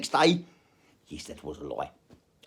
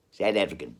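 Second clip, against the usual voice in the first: low flat South African "South African"; 6 semitones lower than usual. Acted. Arguing with a mate over which accent, a low South African reply. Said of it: "I'll go back to South African".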